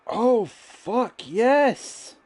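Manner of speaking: loud, high excited yell; sudden burst of glee; excited outburst. Real, not acted.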